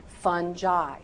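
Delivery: "fungi" as "fun-jai", soft G. Shown as a demonstration. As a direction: say "fungi" with a J sound. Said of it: "I want you to pronounce that".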